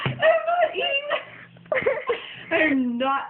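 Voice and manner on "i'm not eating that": shrieking laugh, much higher; disgusted laughing shriek. Real, not acted. Disgusted by strange peanut butter.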